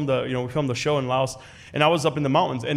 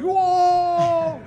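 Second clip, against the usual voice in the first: high drawn-out astonished whoa; the aunties' long surprised cry; amazed whoa; 5 dB louder than usual. Retold or imitated. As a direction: stretch it in wonder. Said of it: "aunties ... were like".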